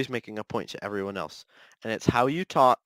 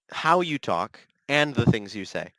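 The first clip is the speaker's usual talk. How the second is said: ordinary delivery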